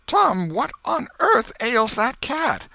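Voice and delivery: put-on high, slow, sharp old aunt; a suspicious old lady demanding answers; Aunt Polly. A narrator's reading. Old aunt questions her nephew, high and slow. Aunt scolding boy over cat.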